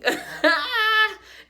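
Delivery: high shrieking laugh; loud high laugh. Real, not acted. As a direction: burst out laughing at yourself. Laughing at own impression.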